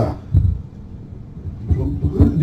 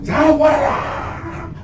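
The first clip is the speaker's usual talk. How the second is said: drawn-out hammed-up shout, much higher; mock exorcist yell; 11 semitones higher, 3 dB quieter, much slower than usual. Puerto Rican exorcist yelling at the demon, hammed-up drawn-out shout. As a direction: yell it slowly like a showy exorcist. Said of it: "gritan un montón".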